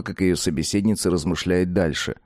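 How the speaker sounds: plain reading voice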